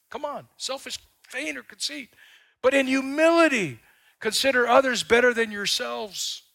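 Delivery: high, loud preaching; fiery preaching. Acted. Preacher pressing the congregation hard, fiery. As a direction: drive the point home to the congregation.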